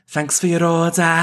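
thick Scouse, lifted in pitch; Scouse accent. Acted. Florist thanking a customer for the order, Scouse accent. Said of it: "an extensive Scouse accent".

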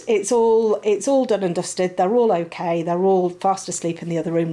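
normal talking voice

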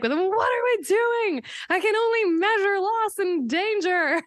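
high, frantic mock panic; over-the-top panicky voice; panicking ego. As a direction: squeal it like a hysterical worrier. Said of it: "The ego's like".

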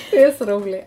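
real giggle through the words; laughing. Real, not acted. Co-host amused by host's oil idea, laughing. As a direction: talk while cracking up. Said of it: "att vi skrattar nu".

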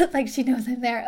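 usual speaking voice

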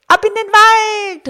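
put-on high, loud children's call; fröhliches Rufen. A narrator's reading. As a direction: call out high and long, setting off. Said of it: "riefen die beiden". (hohes, lautes Losrufen)

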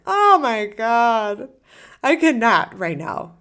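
loud, drawn-out groan of overload; a mock burnt-out outburst, too tired to decide dinner; overwhelmed exasperation. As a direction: sound fried at the end of the day. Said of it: "And you're like".

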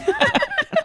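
very high squealed burst of laughter; squealing laugh. Real, not acted. Dad laughs at daughter's swear.

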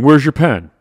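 angry boss. Retold or imitated. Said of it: "And he goes".

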